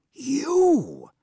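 sudden high, loud shout. Acted. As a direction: hurl it at the dwarf. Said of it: "shouted Mabon".

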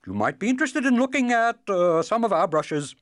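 fast, high, loud nervous peddler; nervous, hurried. Acted. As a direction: rush it out, stammering with fear. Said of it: "he added quickly".

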